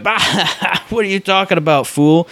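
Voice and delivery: playful dismissive jeer; mock scoffing. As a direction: laugh off a friend's claim, retold. Imitates friend's dismissive reply.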